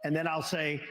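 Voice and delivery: ordinary talk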